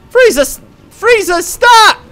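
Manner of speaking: very high, loud pleading; hammed-up high-pitched cry; alarmed hero cry. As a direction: cry out as a panicked cartoon hero. Voicing heroes pleading with Frieza.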